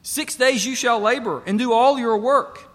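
loud, high, driving home "Six days you shall labor and do all your work"; preached emphasis. Shown as a demonstration. Preacher repeating the verse to a congregation, loud and emphatic. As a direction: ring out "labor" and "all" like a call from the pulpit. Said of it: "Did you hear that?".

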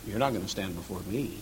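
low, soft, sober statement; understated, grave reply; quiet, direct warning. Retold or imitated. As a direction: say it quietly, eye to eye. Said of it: "look 'em right in the eye and say".

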